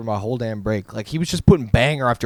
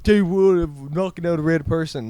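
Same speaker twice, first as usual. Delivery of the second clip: lightly put-on prankster announcer, pitched up; raised mock intro voice; imitated video intro; 5 semitones higher than usual. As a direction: announce the stunt like a video host.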